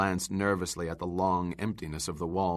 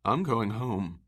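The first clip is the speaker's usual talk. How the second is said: usual speaking voice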